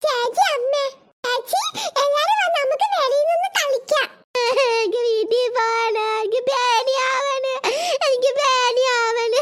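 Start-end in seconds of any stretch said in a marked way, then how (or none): 4.4-9.4 put-on slow, droning child's wail; acted homesick sobbing; crying, frightened. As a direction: bawl with fear, stretching each word. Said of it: "പിന്നെ എന്തിനാ കിടന്ന് കരയുന്നേ".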